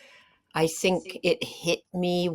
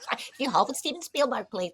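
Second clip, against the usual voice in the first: operator line repeated through a laugh, quick and higher; laughing retelling; 8 semitones higher, faster than usual. Retold or imitated. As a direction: say it again, laughing at the memory. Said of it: "And it was just like".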